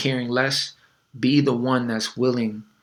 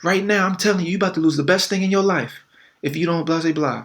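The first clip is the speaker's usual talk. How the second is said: mock ultimatum; 6 semitones higher than usual. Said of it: "she's like".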